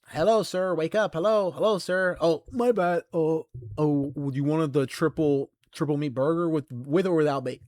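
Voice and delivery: imitating a drive-thru worker; mock service worker. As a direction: play the cashier trying to wake a dozing customer. Mock service worker waking daydreamer.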